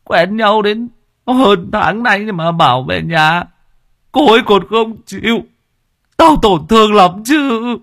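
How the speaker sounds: high, slow, tearful grumble; sulky whimpering. A narrator's reading. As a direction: drag it out, wounded and sulking. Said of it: "Nghĩa mếu máo, hậm hà hậm hực". (hậm hực mếu máo)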